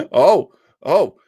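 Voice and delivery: usual speaking voice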